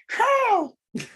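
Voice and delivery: a mocking falsetto Batman; squeaky high Batman. Mocking the Batman voice [name] called too high, in squeaky falsetto. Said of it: "it was weird when he was like".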